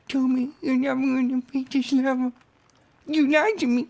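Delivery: high, whiny put-on girl voice; Angelica voice. Angelica character voice.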